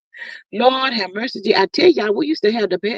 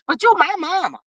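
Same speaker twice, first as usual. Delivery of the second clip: higher, whiny son protesting; son's voice; 7 semitones higher than usual. Retold or imitated. Son answering his mama, higher protesting voice. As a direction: play the son pleading with his mother. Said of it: "the boy minds all he say".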